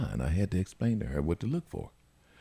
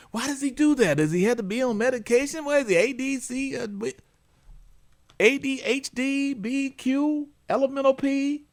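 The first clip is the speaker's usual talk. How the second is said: high, worried mock mom rattling off diagnoses; a fretful mother, high and a bit louder; mimicking the mother; 3 dB louder than usual. Host imitating the anxious caller, high and worried.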